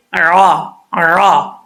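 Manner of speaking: ordinary talk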